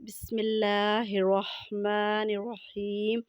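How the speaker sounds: slow, measured Quranic recitation. Acted. Teacher opening the lesson with slow devout recitation. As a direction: intone the opening slowly and devoutly.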